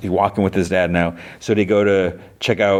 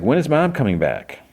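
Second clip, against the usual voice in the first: put-on childlike question; 7 semitones higher than usual. Young Lucy asks father.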